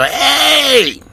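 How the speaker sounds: loud, high call from down at the foot of the door; yelling up at the door. Pretend. Officer hollers up to the woman in apartment four B, loud and high. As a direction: holler up, keeping your distance. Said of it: "stand down at the foot of the door and just yell".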